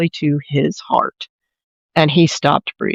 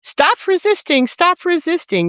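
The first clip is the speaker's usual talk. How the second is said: high-pitched mock yell, no real volume; retold scream; 10 semitones higher than usual. Officer yelling at a handcuffed man, retold scream. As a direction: imitate an officer's repeated command, pitched way up. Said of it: "all of a sudden starts screaming".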